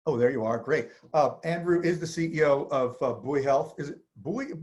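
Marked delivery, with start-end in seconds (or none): none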